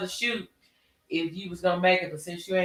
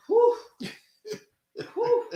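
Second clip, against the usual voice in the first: whew. Real, not acted. Dress no longer fits.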